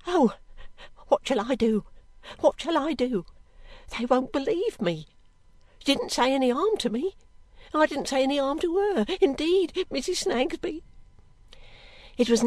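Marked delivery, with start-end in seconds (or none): 0.1-10.8 higher, pleading maid's voice; distressed servant girl. Acted. Girl begging Missus Snagsby to believe her, distraught. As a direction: protest innocence, growing more anxious and higher.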